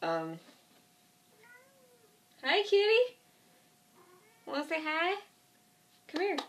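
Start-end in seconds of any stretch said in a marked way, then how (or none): 2.4-6.4 high cooing pet voice. Real, not acted. Greeting her cat mid-video, high cooing baby talk. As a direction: coo up high to the kitty.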